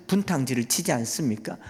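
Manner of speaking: ordinary delivery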